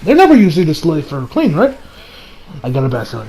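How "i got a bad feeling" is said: ominous murmur. Acted. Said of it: "Kirito says".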